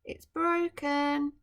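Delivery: a mild mock worry, slowing on it's broken; worried Emperor. Guessing the Emperor's thoughts for the child, mild dismay. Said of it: "he's going to be thinking".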